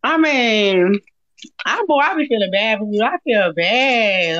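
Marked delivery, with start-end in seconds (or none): none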